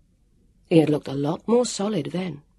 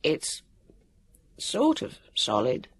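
choky voice; 4 dB quieter, slower than usual. A narrator's reading. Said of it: "his voice came out choky".

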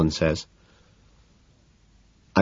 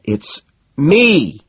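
shouting; 10 semitones higher, 6 dB louder than usual. A narrator's reading. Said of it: "Evelyn shouts".

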